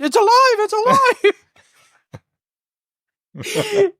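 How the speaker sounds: high, loud imitation French accent. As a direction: cry out in mock-French alarm. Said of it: "in a very French accent".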